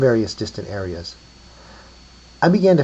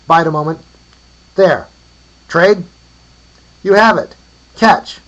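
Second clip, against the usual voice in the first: high, loud, short hollers; calling across distance; 8 semitones higher, 11 dB louder than usual. A narrator's reading. Trading and tossing a bone, calling out. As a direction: shout each bit to carry.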